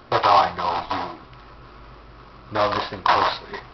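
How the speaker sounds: low, menacing horror-villain voice; psychopath killer voice. Acted. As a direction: speak like a sinister puppet master. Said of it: "So you're some psychopath killer".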